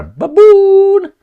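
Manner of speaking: high, loud mocking squeal; sudden high-pitched jeer. Real, not acted. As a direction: jeer the word like an insult. Mocking the minister's title.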